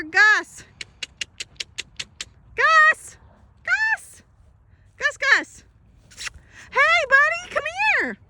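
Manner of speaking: high, loud calls across the field; calling out. Real, not acted. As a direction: call the steer from far away, pitch way up. Calling the steer from afar.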